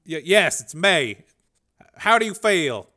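nervous and strained; nervous Dev. A narrator's reading. Scientist talking to his program, nervous.

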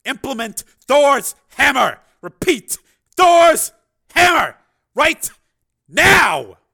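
slow, high bellow, word by word; full-force commander's shout; shouted order. Acted. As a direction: roar the command down the phone. Shouts emergency order into phone.

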